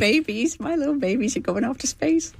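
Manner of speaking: high, emotional mock-mother wail; doting mother. Trainer recalls watching her crew launch, high motherly fretting. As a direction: coo over your babies in a high voice.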